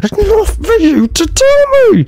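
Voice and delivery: very high, strained whine; high whiny voice. Pretend. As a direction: squeal it out like a spoiled kid. Mock whiny American rant voice.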